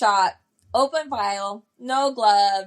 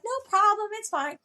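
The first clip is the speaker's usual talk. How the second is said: kindly wife; 11 semitones higher than usual. Retold or imitated. Wife reassures after vomiting.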